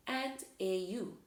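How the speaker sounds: usual speaking voice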